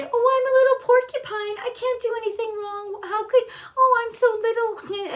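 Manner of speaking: high, crying baby voice playing the victim; whiny little porcupine. Mocking a defensive person who plays innocent, in a high teary whine. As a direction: whimper it like a tiny helpless creature. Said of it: "the people could easily say".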